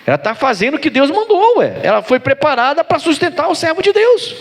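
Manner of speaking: high, insistent preacher; impassioned sermon protest; fervent preaching. Real, not acted.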